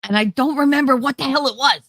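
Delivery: exasperated. Real, not acted. Frustrated at forgetting the word.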